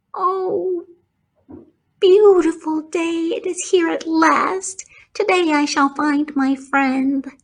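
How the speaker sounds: high, bright-eyed piglet voice; eager young pig. Acted. Wilbur greeting the dawn, hopeful piglet voice. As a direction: sing out the hope of the morning.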